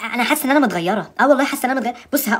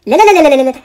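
loud string of no; shouting no in alarm; loud protest; 2 semitones higher, 8 dB louder than usual. Real, not acted. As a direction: protest loudly at the screen. Loud refusal at filter.

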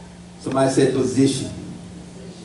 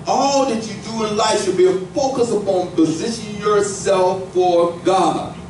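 high, rising preacher's climb; full-force preaching; 10 semitones higher than usual. Acted. Pastor preaching to his church, high and fervent. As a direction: lift the congregation higher and higher.

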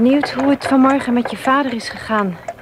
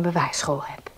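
whisper; 4 semitones lower, 5 dB quieter than usual. Acted. Whispered aside at parting.

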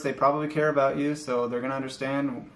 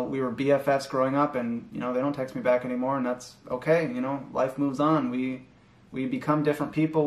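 normal talking voice

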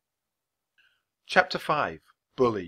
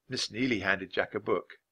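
ordinary delivery

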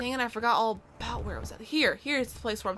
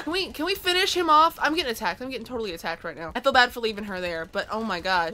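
usual speaking voice

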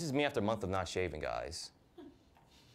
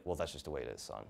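dad voice; 9 semitones lower, 7 dB quieter than usual. Retold or imitated. Dad voiced, low flat.

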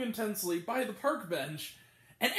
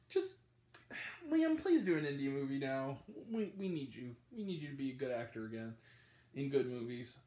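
much lower and softer, earnest appeal; sincere plea; 8 semitones lower, 5 dB quieter than usual. Real, not acted. Reviewer pleads with Liam Neeson, lower and softer. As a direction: ask him gently to come back.

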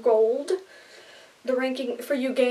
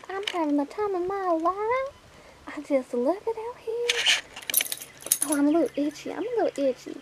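high prissy lady voice; 7 semitones higher, 3 dB quieter than usual. Pretend. Porch ladies, dog replies.

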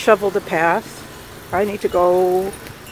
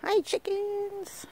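high, slow soft coo; sing-song pet talk; 11 semitones higher, 11 dB quieter, slower than usual. Acted. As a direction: coo gently at the hens. Greeting chickens softly.

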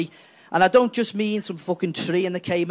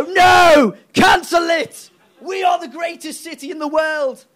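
much higher, loud indignant shouting; mock shout; 10 semitones higher, 8 dB louder, slower than usual. London refusing to leave, shouting high and loud. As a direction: scream it like an outraged city. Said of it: "They're just gonna go".

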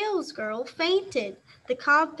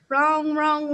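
louder ringing piano notes; loud piano clang; 2 semitones higher, 5 dB louder than usual. A narrator's reading. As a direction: bang out the keys again. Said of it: "wrong went the piano".

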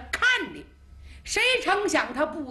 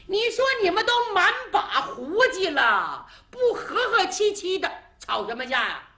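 put-on child's voice; 9 semitones higher, 5 dB louder than usual. Child scolds two old men.

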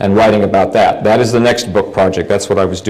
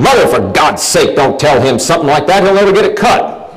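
exasperated mother; 6 semitones higher than usual. Retold or imitated. Mother scolding grandmother, retold.

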